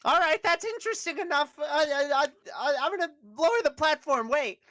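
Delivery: a small creature calling down; high little character voice. Acted. GM voicing small NPC.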